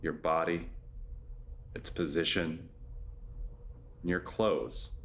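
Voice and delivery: low, measured yoga nidra voice with long pauses; guided meditation. Acted. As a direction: guide them gently into rest, low-pitched and unhurried.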